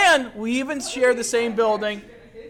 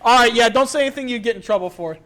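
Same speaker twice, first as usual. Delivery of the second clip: ordinary delivery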